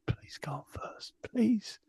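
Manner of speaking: whispered plea, rising into a high "please"; pleading aside. Retold or imitated. Promoter takes his friend aside to go on first, whispered begging. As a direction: beg under your breath, then let it squeak up. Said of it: "takes me aside and goes".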